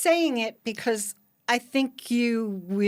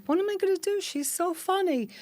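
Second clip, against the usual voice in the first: anxious high voice; 9 semitones higher than usual. Retold or imitated. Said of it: "I'm like".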